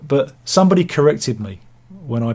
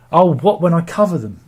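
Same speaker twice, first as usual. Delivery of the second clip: louder, higher "oh, what"; sudden realisation; 4 semitones higher, 6 dB louder than usual. Real, not acted. To the viewer who asked about auctions, a sudden realisation. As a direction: catch on all at once.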